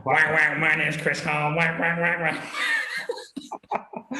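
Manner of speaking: quacking parody of a man introducing himself; mock him as a quacking duck. Friend teasing the host, quacky duck voice. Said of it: "you sound like a duck".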